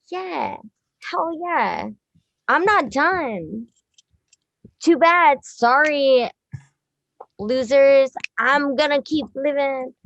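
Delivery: drawn-out, jokey swagger; mock defiance. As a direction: crow it as a joking taunt. Mock defiance, then kidding.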